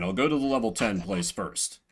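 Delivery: ordinary delivery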